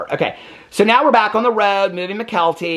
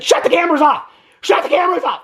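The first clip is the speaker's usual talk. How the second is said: high yelled order, much higher; mock screaming; 12 semitones higher, 3 dB louder, faster than usual. Mimicking McKelty screaming at the camera crew, shrill yell. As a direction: shriek it like the angry cast member. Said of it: "she's, like, screaming and yelling".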